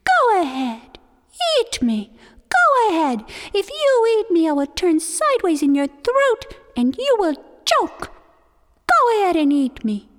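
high, loud defiant turtle dare. Acted. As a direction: puff up and dare him to eat you. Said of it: "said to Coyote defiantly".

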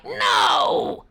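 very loud high roar; furious roar; tiger roar. Acted. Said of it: "roared the tiger".

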